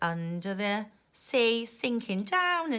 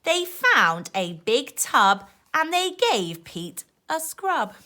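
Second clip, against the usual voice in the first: put-on lively rhyming read-aloud for kids; reading to children; 4 semitones higher, 6 dB louder, slower than usual. A narrator's reading. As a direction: bounce the rhymes like a bedtime book. Reading rhyming picture book aloud.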